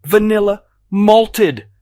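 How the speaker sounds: enraged customer roars his order; furious roar. A narrator's reading. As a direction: roar it at the waitress, spitting. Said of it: "I roar".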